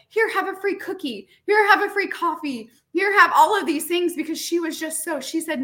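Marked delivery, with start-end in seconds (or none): 0.1-4.2 high, eager, tumbling offers; overjoyed cashier pressing gifts on you; high excited gushing; gushing gratitude. Retold or imitated. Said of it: "she was like".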